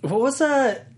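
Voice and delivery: put-on high, slow British accent. Host musing to co-hosts in high British accent. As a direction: wonder aloud in the high British voice. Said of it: "You use that accent for anyone British".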